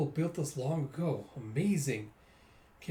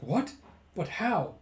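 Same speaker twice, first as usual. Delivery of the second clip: higher, startled questioning; acted surprise; 4 semitones higher, slower than usual. Characters hearing the plan, mildly startled. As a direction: play it taken aback, pitch up.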